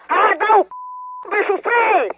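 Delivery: high furious outburst; angry shout. Real, not acted. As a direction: yell the insult in rage. Victim explodes at caller.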